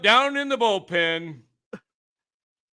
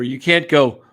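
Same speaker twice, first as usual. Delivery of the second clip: plain; 7 semitones lower, 6 dB louder than usual. Retold or imitated. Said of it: "you can't change your voice".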